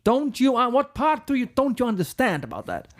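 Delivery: mock-indignant rocker, starting high; cocky rock star. To his girlfriend about his drinking: hammed-up rocker denial. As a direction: brush her off like a swaggering rock star. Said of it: "That was me".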